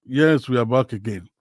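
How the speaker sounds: ordinary talk, nothing marked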